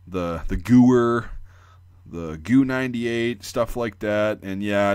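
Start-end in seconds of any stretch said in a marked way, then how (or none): 0.5-1.3 "gooer" drawn out slowly as GOO-wur; louder, slow GOO-wur for "gooer"; butchered pronunciation. Retold or imitated. Quoting people on YouTube, butchered "gooer" slow and loud. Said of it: "people calling it".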